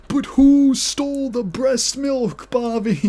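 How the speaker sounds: slow, drawn-out high accusing question with WHO stretched; hammed-up accusation. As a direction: dramatically drag out who to corner Bobby. Mock-dramatic accusation aimed at Bobby.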